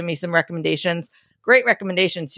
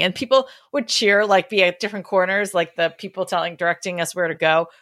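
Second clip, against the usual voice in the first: normal talking voice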